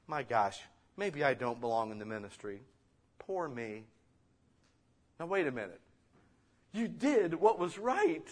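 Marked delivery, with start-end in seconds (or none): 0.1-3.9 low, soft, sorry-for-myself moan; mock self-pity. Pastor mocking his own self-pity, low and soft. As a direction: play up the wallowing pastor lightly. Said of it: "into a pit of despair".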